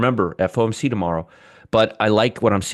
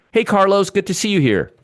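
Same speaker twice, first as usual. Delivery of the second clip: high-pitched, louder hello; excited greeting; 13 semitones higher, 4 dB louder than usual. Real, not acted. Host welcomes a regular viewer, excited high greeting. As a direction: greet with a burst of higher pitch.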